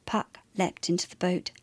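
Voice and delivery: ordinary delivery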